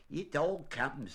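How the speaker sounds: stern rabbit laying down the law. A narrator's reading. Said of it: "said Rabbit sternly".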